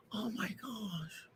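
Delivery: breathless unvoiced gasp of dismay; whispered shock. Real, not acted. Stunned by the glitch on her live stream, whispering. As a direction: hush it out, stunned.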